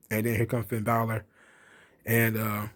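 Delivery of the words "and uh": usual speaking voice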